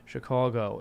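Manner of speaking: "Chicago" as shuh-KAW-go, rounded vowel; older Chicagoan pronunciation. Retold or imitated. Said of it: "does the opposite of a Chicago accent".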